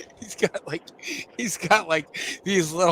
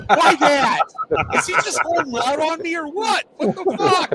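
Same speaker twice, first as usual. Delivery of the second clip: mock outrage; 11 semitones higher than usual. Mock indignation, high voice.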